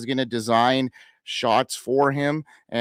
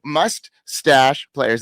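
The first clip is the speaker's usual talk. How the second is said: put-on slow, drawn-out Canadian accent; 3 semitones higher, 6 dB louder, much slower than usual. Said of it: "That's my Canadian accent you guys hear".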